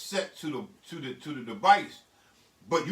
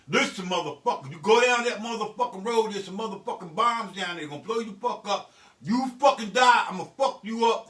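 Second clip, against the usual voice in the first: loud, forceful street-style warning; building to a shouted threat; hard cussing orders; 3 semitones higher, 6 dB louder than usual. Acted. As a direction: shout it like a military chewing-out. Acts out cussing military orders.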